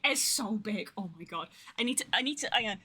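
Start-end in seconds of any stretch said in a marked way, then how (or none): none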